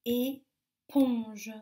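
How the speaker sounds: ÉPONGE as a long é, pause, ponge; split into syllables. Shown as a demonstration. Teacher gives children a word, ÉPONGE split in two syllables. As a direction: break ÉPONGE into two stretched beats. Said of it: "première ou dans la deuxième syllabe".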